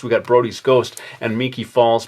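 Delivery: usual speaking voice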